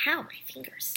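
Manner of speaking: whispered aside of pain. Real, not acted. Complaining about sore fingers mid-video, whispered ow. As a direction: breathe it out under the voice.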